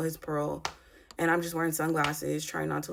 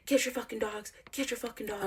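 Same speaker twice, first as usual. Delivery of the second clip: higher-pitched imitation of an angry man; retold angry order; 6 semitones higher, 3 dB quieter than usual. Retelling his order about the dogs, higher mock yell.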